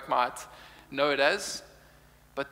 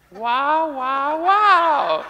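much higher and louder, laughing announcer "wow"; intercom announcer again; 10 semitones higher, 8 dB louder, much slower than usual. Retold or imitated. Preacher reprises the store intercom voice, high, loud and laughing. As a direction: belt it like the intercom lady while laughing.

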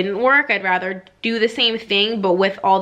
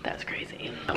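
whispering; 14 dB quieter than usual. Real, not acted. Murmured aside about insemination.

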